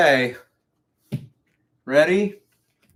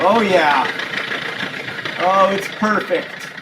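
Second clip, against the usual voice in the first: delighted; 5 semitones higher, slower than usual. Real, not acted. Delight at machine.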